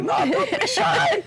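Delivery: put-on very high, slow, shouting Filipino accent; a nurse hollering in a squeaky Filipino accent, drawn out. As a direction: Filipino accent, nurse coaxing him loudly. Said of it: "there was a Filipino nurse".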